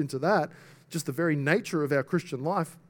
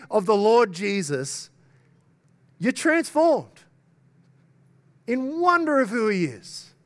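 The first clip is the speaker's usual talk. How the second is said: raised, high-pitched preaching voice; impassioned preaching; 7 semitones higher, 5 dB louder than usual. Real, not acted. Preacher urging congregation to behold Christ, loud and impassioned. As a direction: build the sermon to a ringing high point.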